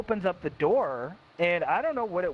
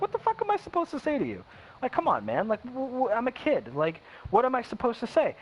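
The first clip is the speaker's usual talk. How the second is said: higher-pitched, pleading retort; exasperated kid protest; 6 semitones higher than usual. Retold or imitated. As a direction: protest like a cornered kid, retold. Kid protesting to angry stepfather.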